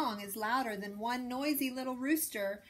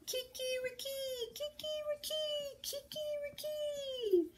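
high repeated rooster crowing; triumphant rooster crowing; rooster crows; 3 dB quieter than usual. A narrator's reading. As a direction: crow out again and again.

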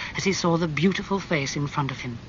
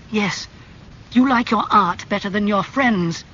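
louder, higher hurt accusation; angry; 6 semitones higher, 5 dB louder than usual. A narrator's reading. Dorian accusing Basil, angry and raised. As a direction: throw the reproach at him. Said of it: "his face red and angry".

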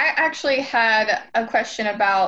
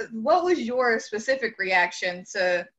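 ordinary delivery

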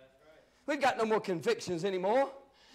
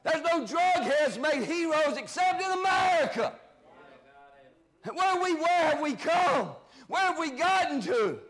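fired-up preaching; 4 semitones higher than usual. Real, not acted. Preacher rebukes the congregation over false heroes, fired-up preaching.

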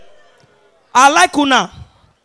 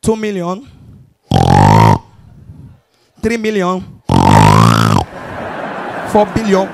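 low-pitched words between long snores; rich man snoring with swag; 9 semitones lower than usual. Shown as a demonstration. Rich men snoring about their account, low mock sleep-talk. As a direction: count money in a low, sleepy rumble. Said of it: "then they snore with swag".

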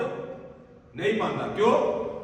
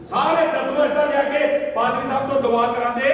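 louder, higher pulpit preaching at full force; fervent preaching; 8 semitones higher, 8 dB louder than usual. Acted. Preacher telling the congregation how to give, fervent loud preaching. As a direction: drive it home to the congregation.

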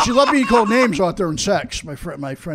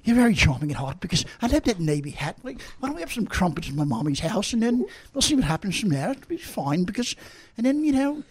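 flirt quietly in a put-on English accent; 6 dB quieter than usual. Shown as a demonstration. Guest flirts with Sue on request, in a mock English accent. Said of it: "do your English accent".

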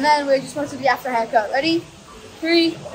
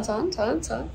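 normal talking voice

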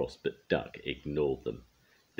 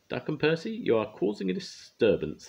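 high-pitched, raised-voice scolding; thundering; 13 semitones higher, 4 dB louder than usual. A narrator's reading. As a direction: thunder at them as the one in charge. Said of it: "thundered the Fat Controller".

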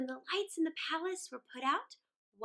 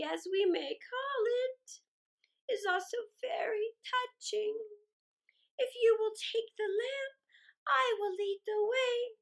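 higher, slow, mannered lady crow; gracious hostess crow, drawn out; prim tame crow; 6 semitones higher, 4 dB louder than usual. Acted. Tame crow welcoming little Gerda, higher and slow.

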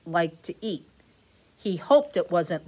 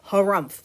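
grumpy harumph; 7 dB louder than usual. Acted. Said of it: "said Walrus".

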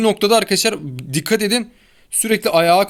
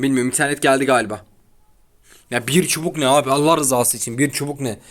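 ordinary delivery

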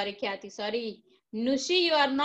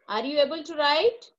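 slow question; 3 semitones higher, 4 dB louder, much slower than usual. Shown as a demonstration. Teacher asks a child online.

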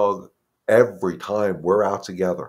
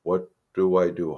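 ordinary talk, nothing marked

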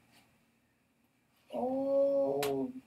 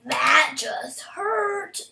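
pained shout; 6 dB louder, much slower than usual. Real, not acted. Reacting to in-game pain.

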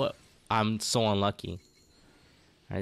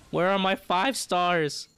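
pleading whine; 8 semitones higher, 4 dB louder, slower than usual. Real, not acted. Pleading for a five star pull.

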